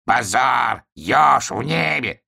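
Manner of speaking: high, drawn-out crow squawk; crow's outcry. Acted. Crow denounces flying hedgehog, high drawn-out cry. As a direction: stretch the words in scandalised cries. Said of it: "закричала ворона".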